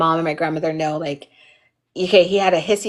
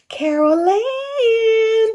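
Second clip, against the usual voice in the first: long, much higher drawn-out call of the name; eerie sing-song call; much slower than usual. Retold or imitated. Imitating the Poltergeist girl calling, high drawn-out sing-song. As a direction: call it like a ghost through the TV. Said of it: "I used to always go".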